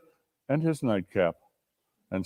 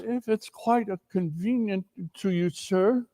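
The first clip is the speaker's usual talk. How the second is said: higher and softer, meek clerk asking; timid clerk; 7 semitones higher, 8 dB quieter than usual. Acted. The clerk timidly asks Scrooge for Christmas off, meek. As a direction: ask shyly, hat in hand.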